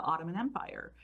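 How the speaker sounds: ordinary talk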